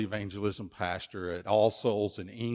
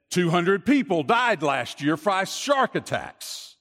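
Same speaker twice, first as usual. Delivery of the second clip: loud, high, drawn-out retort; emphatic retort; 7 semitones higher, 5 dB louder than usual. Retold or imitated. Friend answering the man who doubts the danger, emphatic retort. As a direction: hammer the fact home, slowly. Friend warns swimmer with grim fact.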